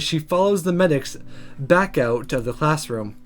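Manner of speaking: ordinary delivery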